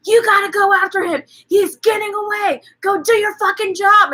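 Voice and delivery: flipping out. Retold or imitated. Husband yelling at cops to chase the car, high frantic shout. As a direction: yell it like a man losing it. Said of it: "he's, like, flipping out".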